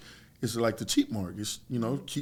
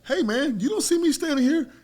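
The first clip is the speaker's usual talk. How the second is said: higher and louder, challenging a line-cutter; retold angry confrontation; 7 dB louder than usual. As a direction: call him out loud for cutting in. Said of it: "This time, I had a bad attitude".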